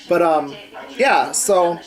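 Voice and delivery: ordinary talk, nothing marked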